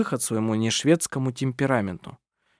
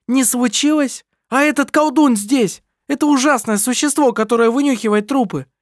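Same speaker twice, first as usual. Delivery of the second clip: heated quarrel; 12 semitones higher, 5 dB louder than usual. A narrator's reading. Heated quarrel among siblings.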